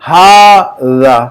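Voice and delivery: हाजा very slowly, first "haa" held long; long alif sound. Shown as a demonstration. Teacher showing students the unwritten alif, long drawn-out vowel. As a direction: stretch हाजा out as "haaa-dhaa". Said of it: "आवाज निकल रही है अलिफ की".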